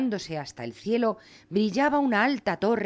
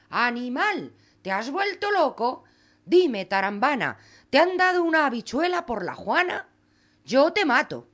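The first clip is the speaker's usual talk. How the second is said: higher-pitched furious mother yelling at her son; angry mother scolding; 6 semitones higher than usual. Acted. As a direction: scold him, outraged at the bean. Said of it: "le gritó".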